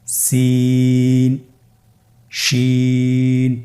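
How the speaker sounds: সিন and শিন held long and even; long held Arabic letters; drawn-out letter names. Shown as a demonstration. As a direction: stretch each letter name slowly. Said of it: "হরফগুলো হলো".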